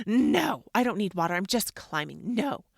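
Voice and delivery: loud mock-child refusal; defiant kid. Son refusing mom's water, loud mock defiance. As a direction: imitate a kid's stubborn protest, hard on each no.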